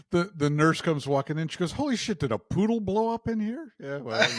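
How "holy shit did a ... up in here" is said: high-pitched, incredulous retold exclamation; mock astonishment. Nurse walks in on the shaved hair, mock astonishment. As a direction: exclaim it high, imitating a stunned onlooker.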